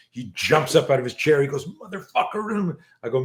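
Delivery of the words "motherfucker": high, drawn-out curse through mock fury; fuming outburst. Fuming boy jumps from his chair to curse, imitated fury. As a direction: act out a seething teenager, stretched and higher. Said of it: "he's fuming".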